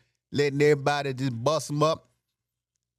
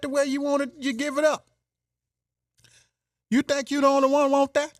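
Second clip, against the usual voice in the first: mocking taunt; 9 semitones higher, 3 dB louder than usual. Mocking rant in high voice.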